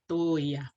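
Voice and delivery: tōia with the o clearly lengthened; vowel drawn out for learners; elongated o. Shown as a demonstration. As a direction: stretch the macron o in tōia.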